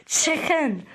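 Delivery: put-on Chinese accent; mock Chinese accent.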